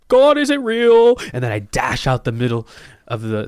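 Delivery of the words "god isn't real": very high, loud and slow; shouted declaration. Pretend. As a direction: blast it into the mic. Shocking declaration into mic.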